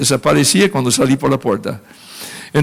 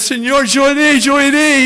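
put-on eager childhood prayer; 10 semitones higher than usual. Pastor retells his childhood prayer.

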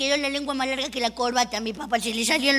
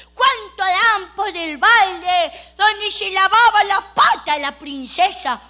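slow, loud bid call; auctioneer call; 4 semitones higher, 7 dB louder, slower than usual. Retold or imitated. Auctioneer asks for bids on the bucket, slow. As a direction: stretch out the question to the room. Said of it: "el del martillito".

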